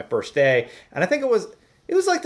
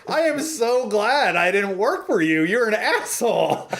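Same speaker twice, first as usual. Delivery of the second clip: higher, slow, defiant tell-off; retort; 8 semitones higher, slower than usual. Retold or imitated. Teen telling off the rude boss, defiant. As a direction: let him have it, slowly.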